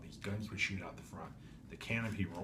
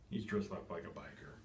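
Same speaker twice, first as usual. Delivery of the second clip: ordinary delivery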